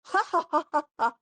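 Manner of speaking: high spelled-out ha ha ha laugh; a little gnome laughing at his rival; hammed-up high gnome chuckle. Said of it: "And he kinda laughs".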